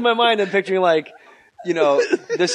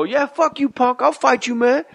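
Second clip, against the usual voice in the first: higher belligerent taunt; higher schoolyard challenge; tough-kid taunt; 7 semitones higher than usual. Retold or imitated. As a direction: pick a fight at school. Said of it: "people would go".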